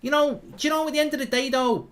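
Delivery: very high Scouse accent attempt; Carragher impression. Attempting Jamie Carragher for the stream, high Scouse accent. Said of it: "I can't really do Carragher".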